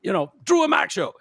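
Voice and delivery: a radio host mocking himself pulling rank on a cop; mock entitled celebrity. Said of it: "I would never be like".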